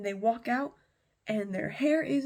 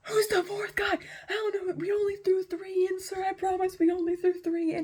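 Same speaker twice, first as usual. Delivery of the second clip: high, loud wailing protest; acted panic; 9 semitones higher, 5 dB louder than usual. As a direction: plead tearfully with the king in mock panic. Panicked men plead with the king.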